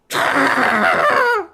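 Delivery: loud, high celebratory cry; elated drawn-out yell; long victory scream. Real, not acted. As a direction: let out one long triumphant scream. Celebrating the win.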